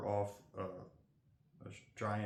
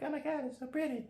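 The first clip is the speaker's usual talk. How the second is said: high, squealy gushing voice; mock gushing fiance; 4 dB louder than usual. Imitating his fiance gushing over a pretty card, high squealy voice. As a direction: gush over the pretty card like a smitten girlfriend. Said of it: "she'll be like".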